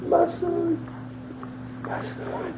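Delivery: soft, breaking, fading to a whisper; tender father. Acted. Old father tenderly greeting his lost son, soft. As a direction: let it fall to a hush.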